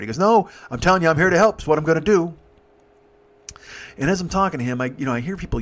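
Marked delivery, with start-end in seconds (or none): 0.2-2.3 louder, higher retold insistence; lightly voiced man who won't take no; insistent helper. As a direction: insist like the helpful stranger. Stranger insists on helping, acted.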